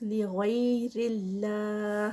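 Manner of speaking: slow chant; recited phrase. Shown as a demonstration. Teacher chanting the words for students, slow. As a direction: draw out Allah. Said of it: "repeats the words as a recitation".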